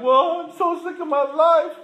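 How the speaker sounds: high, loud, slow mock whimper; mock whiny self. To the counselor, mocking his own self-pity in a high whine. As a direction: ham up a self-pitying whine. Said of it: "then I'm like".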